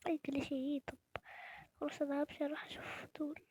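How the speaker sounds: soft hushed aside; whisper. Real, not acted. Vlogger heading to the strangers, hushed whisper. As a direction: whisper as you walk over.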